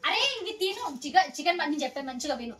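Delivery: mother ordering her son to eat the vegetables; stern scolding. Real, not acted. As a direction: scold him firmly to listen.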